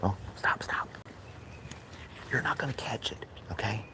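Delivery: hushed whisper to the dog; whispered scolding of the dog. Real, not acted. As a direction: whisper it under your breath. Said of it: "That's why I'm talking low".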